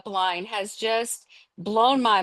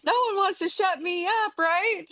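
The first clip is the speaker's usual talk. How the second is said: laughing while joking, voice jumping much higher; 10 semitones higher than usual. Real, not acted. Guest jokes with the host about being unmuted, laughing. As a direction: giggle through the line about being unmuted.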